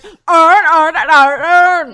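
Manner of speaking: booming exaggerated Baltimore accent. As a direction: blare it in thick Baltimore, all "urn". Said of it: "if you have a Baltimore accent".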